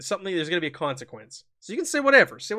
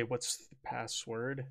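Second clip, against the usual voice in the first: menacing guard; 5 semitones lower, 13 dB quieter, much slower than usual. Acted. Masked guards question the players.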